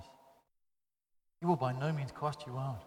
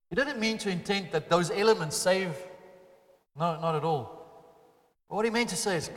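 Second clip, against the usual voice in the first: ordinary delivery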